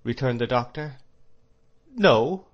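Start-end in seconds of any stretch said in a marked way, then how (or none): 1.9-2.5 high, louder, astonished question; startled rising exclamation. A narrator's reading. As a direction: echo it in disbelief, pitch leaping up. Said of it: "with astonishment".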